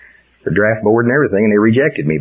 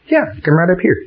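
eager invitation; 9 semitones higher than usual. Acted. Evangelist calling person forward.

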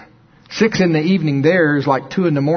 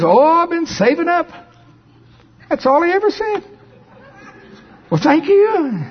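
much higher and louder, still riding the peak; excited telling; 4 dB louder than usual. Acted. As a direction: keep the high excited pitch to the end. Climax of the donor story.

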